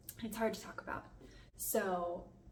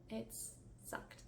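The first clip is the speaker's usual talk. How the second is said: choked up; 2 semitones higher, 10 dB quieter than usual. Real, not acted. Fighting tears over miscarriage.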